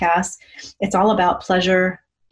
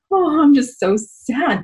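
higher, slow, hammed-up sadness; mock sad sigh; 7 semitones higher than usual. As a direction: play at being sad, half joking. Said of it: "but I was just like".

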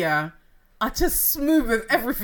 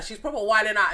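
usual speaking voice

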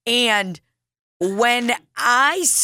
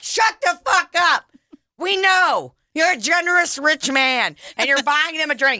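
exasperated outburst; 7 semitones higher than usual. Retold or imitated. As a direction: yell it like you've had enough. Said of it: "so many times that you're like".

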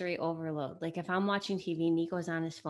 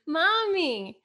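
put-on high, louder insistent child's Mommy; louder child call; 8 semitones higher, 7 dB louder than usual. Ignored kid calls mom again, insistent high child voice. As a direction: call Mommy again, now demanding an answer. Kids calling their silent mom.

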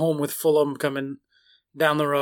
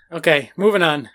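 ordinary talk, nothing marked

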